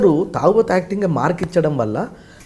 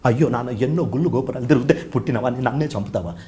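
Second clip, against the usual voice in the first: softly acted plea to a father; acted film plea; 4 semitones lower, 6 dB quieter than usual. Son pleading with his father in Bharateeyudu, softly acted plea. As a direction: plead as the son, voice dropping away. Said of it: "ఇదే కమల్ హాసన్ గారికి వచ్చేసరికి".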